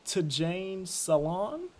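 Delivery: "Tidjane Salon" sounded out slowly, syllable by syllable; careful slow name attempt. Shown as a demonstration. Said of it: "not about to try to pronounce this name".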